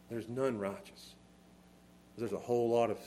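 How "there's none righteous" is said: ordinary talk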